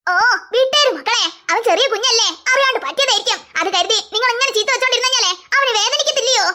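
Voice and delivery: put-on an indulgent grandma taking the boy's side; grandmother defending.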